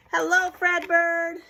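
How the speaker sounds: slow, high, loud hello; laughing crowd greeting. Acted. Crowd after his silly antics greets Fredbird, loud cheer. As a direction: call out as a delighted crowd. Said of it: "they all laughed and they said".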